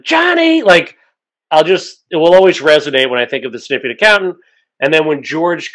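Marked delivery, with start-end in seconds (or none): 0.0-0.6 high-pitched imitation of a yell; imitated yell. Host quoting the actress's iconic line back to her, mock yell. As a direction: call the name out high, mock-yelling. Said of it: "Are you yelling".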